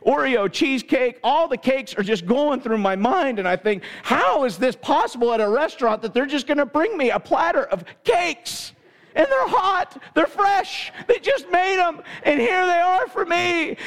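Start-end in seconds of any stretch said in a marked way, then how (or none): 4.0-13.8 put-on excited boy. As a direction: let the kid's dream run away with him. Boy dreams of cake platters.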